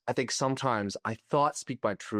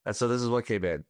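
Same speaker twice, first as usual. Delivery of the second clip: usual speaking voice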